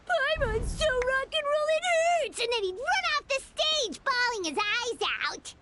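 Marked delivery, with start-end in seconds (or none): 0.1-2.4 high, hammed-up imitation of his yell; mock yell. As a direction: imitate him yelling out, pitched up. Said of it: "Pretty sure he'd yell out".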